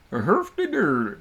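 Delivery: slow, lightly voiced Swedish Chef gibberish. Shown as a demonstration. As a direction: do a quick Swedish Chef bit. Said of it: "Someone asked for a Swedish Chef impression".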